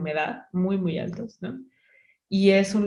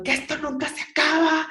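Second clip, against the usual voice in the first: higher, whiny crying complaint; mock tearful wail; 9 semitones higher than usual. Exhausted hiker crying on the descent, mock wail. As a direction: moan that it never ends. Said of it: "yo ya bajé llorando".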